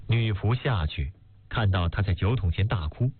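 ordinary delivery, nothing marked

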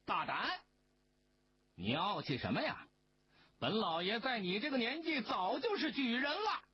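high, haughty angry bark; furious rebuke. A narrator's reading. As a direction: magistrate boasting in anger. Magistrate rages at scholar.

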